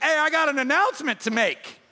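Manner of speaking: high, loud announcement; acted excited proclamation; calling for attention. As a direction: call out over a whole dinner party. Zacchaeus stands to address the room.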